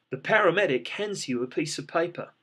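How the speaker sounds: ordinary delivery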